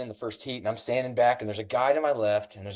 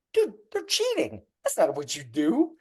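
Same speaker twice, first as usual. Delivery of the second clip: indignant outburst; 7 semitones higher than usual. Retold or imitated. Indignant at cheaters in heat.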